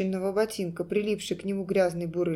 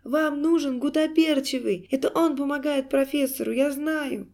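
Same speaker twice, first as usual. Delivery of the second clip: high, frantic insistence; squealing clown; 5 semitones higher, 4 dB louder than usual. A narrator's reading. Clown informing on the culprit, squealing. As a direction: push it out high and urgent. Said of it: "вдруг взвизгнул клоун".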